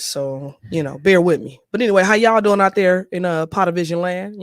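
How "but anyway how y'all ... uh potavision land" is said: excited host greeting. Acted. Host hyping the audience.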